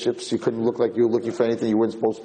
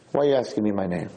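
ordinary talk, nothing marked

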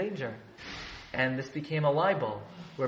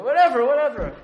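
dismissive; 9 semitones higher, 9 dB louder than usual. Retold or imitated. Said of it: "He said".

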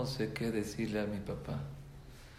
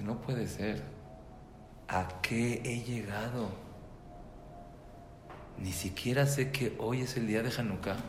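understated remorse, softly spoken; hushed self-reproach, lightly acted; retold remorse; 5 dB quieter than usual. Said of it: "le agarró un remordimiento".

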